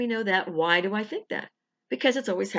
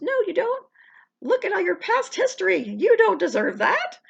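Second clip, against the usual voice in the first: high, loud, sneering inner critic; nagging inner voice; 12 semitones higher, 5 dB louder than usual. Acted. Little voice on the shoulder mocking the viewer, high and sneering. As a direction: squeal it like a doubting little voice on your shoulder. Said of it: "things on your shoulder goes".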